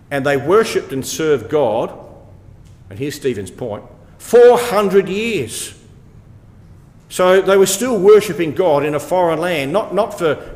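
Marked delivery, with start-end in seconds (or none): 4.2-5.8 "four hundred years" high, loud and drawn right out; emphatic stress. Real, not acted. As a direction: hammer home "four hundred years", stretching each word. Preacher drives home the key point.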